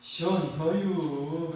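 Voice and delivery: very slow, stretched Chungcheong accent; leisurely Chungcheong drawl; Chungcheong dialect. Shown as a demonstration. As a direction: drag each syllable out like a slow Chungcheong speaker. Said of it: "충청도는 어떻게 할까요".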